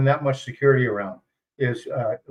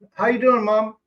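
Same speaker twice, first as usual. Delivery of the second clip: imitating polite locals; 6 semitones higher than usual. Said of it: "always address me as".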